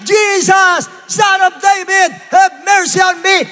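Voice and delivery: high, loud, drawn-out cry. Acted. Bartimeus begs Jesus despite the crowd, shouting. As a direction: holler each phrase at full force. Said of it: "lalong sumigaw".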